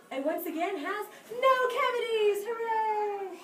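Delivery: loud high cheer. Acted. Cheering the happy ending for the class, loud cheer. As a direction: celebrate with the kids. Celebrating healthy tooth for kids.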